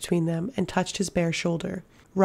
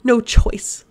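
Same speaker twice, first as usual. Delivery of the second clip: louder, gasping through strain; gasped out; 4 semitones higher, 6 dB louder than usual. A narrator's reading. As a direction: force it out between gasps. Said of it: "the man gasped out".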